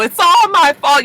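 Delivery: high, loud hammed-up wail; mock the crybaby character; imitating Desna wailing; mock crying Desna. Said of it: "Desna's crying as usual".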